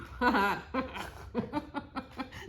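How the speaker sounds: mustache twirling villain cackle; evil laugh. Pretend. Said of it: "This is my evil laugh".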